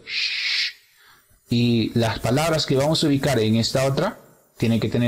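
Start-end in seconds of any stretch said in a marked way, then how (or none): 0.1-0.7 prolonged voiceless "Sh."; sh sound. Shown as a demonstration. Teacher showing which sound words must have, "Sh." as long hush. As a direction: hold "Sh." as a steady hush. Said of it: "que tener este sonido".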